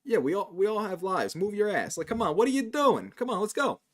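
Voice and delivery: hammed-up Brooklyn Italian telling someone to move; New Yorker Italian accent. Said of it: "but I can do the accent".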